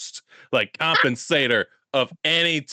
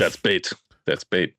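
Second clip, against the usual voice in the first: deep ominous voice; 9 semitones lower than usual. Acted. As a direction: drop way low like a trailer voice. Joking warning, pitch far down.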